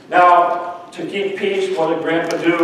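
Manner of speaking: normal talking voice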